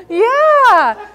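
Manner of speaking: high, loud burst of laughter; laughing yeah; big laugh. Real, not acted. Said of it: "I like Chris".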